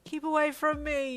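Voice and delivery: loud, high-pitched wail; distressed high protest. A narrator's reading. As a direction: wail at someone to back off. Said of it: "you wailed".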